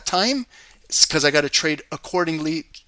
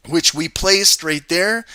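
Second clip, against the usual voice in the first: normal talking voice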